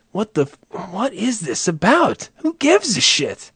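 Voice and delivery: soft voice leaping very high; mock bafflement. Comic puzzled why people watch racing, high incredulous questions. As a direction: squeal the questions softly, stretching them.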